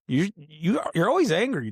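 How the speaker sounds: high-pitched imitation of a fed-up cousin; exasperated calling-out. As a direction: mimic someone protesting, voice rising. Imitates cousin calling out his anger.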